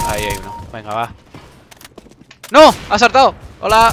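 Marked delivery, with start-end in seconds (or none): 2.5-3.9 raging gamer. Real, not acted. Gamer yells at enemy jumping.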